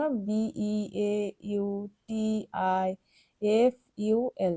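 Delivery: letters spelled slowly one by one; slow spelling out. Shown as a demonstration. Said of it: "beautiful বানানটা আমি লিখে নিই". (ধীরে ধীরে বানান বলা)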